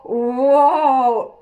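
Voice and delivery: a loud, stunned wow; shocked exclamation. Acted. Reacting to seeing husband with girls.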